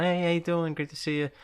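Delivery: high, sing-song social hello; breezy greeting. Acted. Cousin greeting barely-known relatives at a party, breezy small talk. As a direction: toss off a polite family hello.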